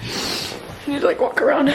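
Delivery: usual speaking voice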